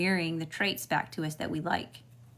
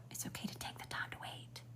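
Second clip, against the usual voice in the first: whisper; 17 dB quieter than usual. Real, not acted. Whispered reassurance to viewer.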